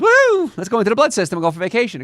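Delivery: high, loud whoop, then fast excited chatter; giddy, rushed yeast cheer; gleeful yeast. Pretend. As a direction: take off on holiday with a whoop. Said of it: "the yeast looks at these little holes and goes".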